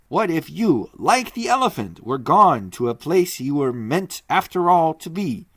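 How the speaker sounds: a resolute man declaring the plan; firm Leo. Acted. Leo Mathienne repeats.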